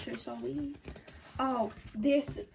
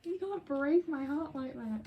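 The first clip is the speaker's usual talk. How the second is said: mock heartbreak; 4 semitones higher than usual. Mock heartbreak over album word.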